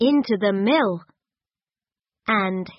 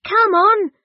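high, loud and lively; eager call; 11 semitones higher, 7 dB louder than usual. A narrator's reading. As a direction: call the children on brightly. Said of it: "said Gran".

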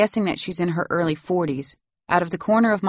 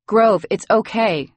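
higher, louder reassuring call; 5 semitones higher, 6 dB louder, slower than usual. A narrator's reading. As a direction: call out slowly to calm a child. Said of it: "I call out as I approach".

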